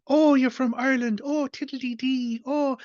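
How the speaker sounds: a clueless foreigner in a comic panel gushes at an Irishman in a hammed-up Irish lilt; mock stage-Irish. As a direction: parody a tourist gushing about Ireland in a stage-Irish accent. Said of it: "the other individual going".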